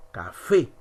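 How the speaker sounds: Café with a loud, punched "fé"; stress on final syllable. Shown as a demonstration. Teacher giving an example of an aguda word, Café stressed on "fé". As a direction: hit the end of Café. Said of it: "la mayor fuerza de voz en la última sílaba".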